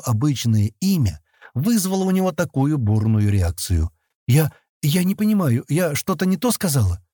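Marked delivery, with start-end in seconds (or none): 4.3-7.0 higher, stammering question; faltering. A narrator's reading. Girl startled by Sten's reaction, faltering question. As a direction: stumble on the first word, uneasy. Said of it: "запинаясь, спросила она".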